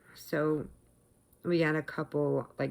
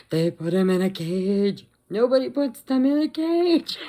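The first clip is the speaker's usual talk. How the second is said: loud wail climbing higher; hammed-up meltdown; 5 semitones higher, 7 dB louder than usual. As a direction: build into an exaggerated, outraged wail. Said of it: "right in the middle of my meltdown".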